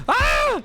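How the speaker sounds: sudden high startled shout; startled yelp. Real, not acted. Streamer caught off guard, high startled yelp. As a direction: yelp in real shock.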